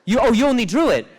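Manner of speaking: loud, high exasperated outcry; exasperated outburst. Real, not acted. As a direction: throw up your hands in loud disbelief. Outburst after discovering author only drew.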